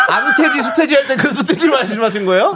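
helpless high laughter through the words; laughing. Real, not acted. Host teases the contestant's garbled answer, laughing. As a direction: squeeze the question out through laughter.

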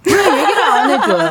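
acting out the fan's whine, laughing; playfully demanding, laughing; mock fan complaint. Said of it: "순식간에 몰입을".

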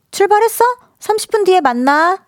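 high, chirpy acted girlfriend; girlfriend calling. Girlfriend calling boyfriend to meet soon, high girlfriend voice.

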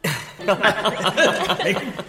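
short mad chuckle; hysterical laugh. Acted. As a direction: laugh like a madman. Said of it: "미친 듯이 껄껄 웃는다".

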